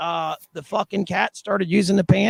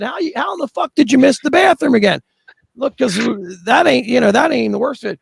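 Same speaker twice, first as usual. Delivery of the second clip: angry scolding; 7 semitones higher than usual. Retold or imitated. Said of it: "I said".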